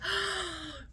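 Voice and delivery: high startled gasp; sharp gasp. Retold or imitated. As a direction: gasp in mock shock. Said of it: "we're like".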